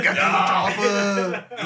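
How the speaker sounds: Arnold impression. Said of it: "like, Arnold's voice from his movies".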